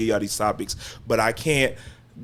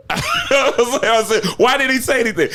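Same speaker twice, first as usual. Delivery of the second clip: much higher, laughing incredulous retelling; mock baffled laugh; high laughing disbelief; 11 semitones higher than usual. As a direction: squeak it out through a laugh.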